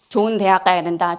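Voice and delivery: ordinary delivery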